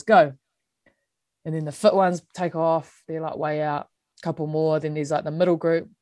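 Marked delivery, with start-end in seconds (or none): 0.0-0.3 short loud "go"; coach's start call. Retold or imitated. Coach starting the fitness run, loud retold command. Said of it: "she blows whistle and goes".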